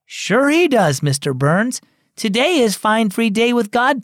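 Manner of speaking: a hint of a young boy, sincere and bright; eager boy voice.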